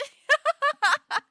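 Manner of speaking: loud, high burst of laughter; shrieking laugh. Real, not acted. Streamer laughing hard at the chest story. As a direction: crack up loudly.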